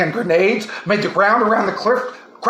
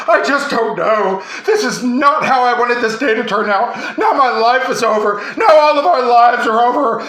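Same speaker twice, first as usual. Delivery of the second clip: crying; 4 semitones higher, 3 dB louder than usual. A narrator's reading. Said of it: "cried Fred".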